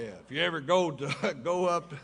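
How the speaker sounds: ordinary talk, nothing marked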